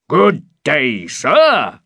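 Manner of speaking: hearty, loud innkeeper's greeting; big friendly hail. A narrator's reading. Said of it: "in a loud, friendly way".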